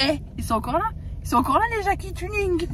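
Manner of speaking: high-pitched mocking imitation of companion; mimicking companion. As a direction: mimic her remark, pitch climbing high. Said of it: "Elle m'a dit".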